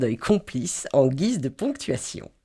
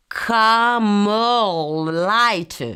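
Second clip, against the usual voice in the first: Camel Light. extremely slow, loud and higher, Camel drawn out; hanging on; 5 semitones higher, 4 dB louder, much slower than usual. Shown as a demonstration. Tourist desperately articulating her order, extremely slow. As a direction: drag out Camel Light. to be understood. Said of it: "Je m'accroche".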